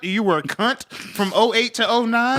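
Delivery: lightly voiced job interviewer; mock interviewer. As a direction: play a polite HR manager. Mock hiring manager.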